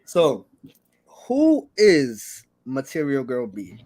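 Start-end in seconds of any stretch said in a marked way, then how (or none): none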